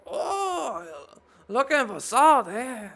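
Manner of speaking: high, loud put-on accent with laugh; Mexican-sounding accent. Dwarf echoing customer, Mexican-sounding accent. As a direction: lean hard into the odd accent. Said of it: "Why you say that like a Mexican?".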